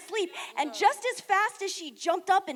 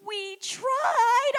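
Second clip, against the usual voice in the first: panicked; 8 semitones higher, 7 dB louder than usual. Retold or imitated. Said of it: "Raya's like".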